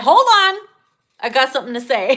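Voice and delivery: barge in like you can't wait; playfully shouting to cut in; mock interruption. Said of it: "horny feminists like".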